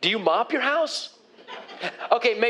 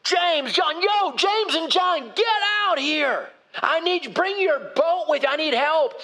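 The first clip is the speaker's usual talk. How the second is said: calling out; 7 semitones higher than usual. Acted. Peter calling James and John to bring their boat, urgent shout. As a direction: yell across the water for help, much higher.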